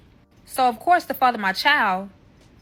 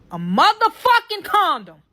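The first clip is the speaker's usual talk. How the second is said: higher, louder outburst of disbelief; louder, higher shocked outburst; outraged shock; 9 semitones higher, 5 dB louder than usual. Real, not acted. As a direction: blurt it out in outrage. Shock at finding condom.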